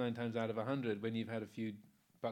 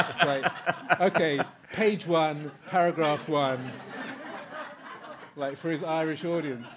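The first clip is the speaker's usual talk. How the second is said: talking through loud laughter; laughing; 5 semitones higher, 14 dB louder, slower than usual. Real, not acted. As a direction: laugh hard while getting words out. Laughing at the film's opening.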